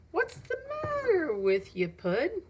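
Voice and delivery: high, slow mock outrage; mocking bully call. Bully calls out to Pud, high mock outrage. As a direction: fake concern thrown across the room.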